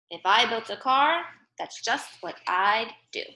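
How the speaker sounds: put-on lively storybook read for young children; playful read-aloud to kids; reading a picture book aloud. Teacher reads picture book aloud.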